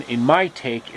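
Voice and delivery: plain reading voice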